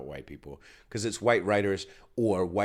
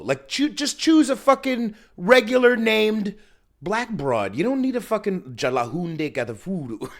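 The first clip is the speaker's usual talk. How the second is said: loud rant; 10 semitones higher, 9 dB louder than usual. Real, not acted. Ranting about casting choices.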